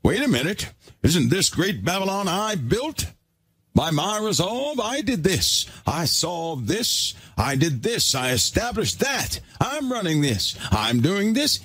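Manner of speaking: a puffed-up king crowing over his city; boastful king. Retold or imitated. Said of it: "King Nebuchadnezzar was up there talking".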